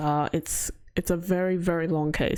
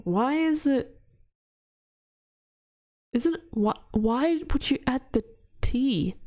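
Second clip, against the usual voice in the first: normal talking voice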